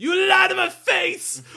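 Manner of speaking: high, drawn-out theatrical shout; imitated line reading. Poirot confronting a liar, hammed-up high shout. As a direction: belt it out slowly as overacted outrage. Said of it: "his line reading of".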